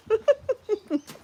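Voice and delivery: high-pitched giggling laugh; helpless laugh after a silly outburst; laughing at oneself. Real, not acted. Laughing at own rant about eggs, high giggle. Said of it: "And I'm laughing at myself".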